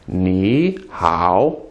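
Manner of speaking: "ni" rising, then low dipping "hao"; second-tone ni. Shown as a demonstration. Teacher showing tone change, rising "ni" in "ni hao". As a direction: lift "ni" upward before a low "hao". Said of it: "make the first one a second tone".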